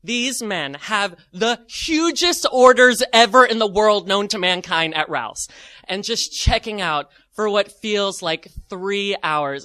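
ordinary delivery, nothing marked

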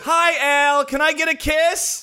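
gushing fan voice. Retold or imitated. Imitating Today Show fan.

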